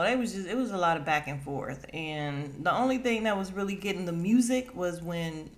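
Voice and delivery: ordinary delivery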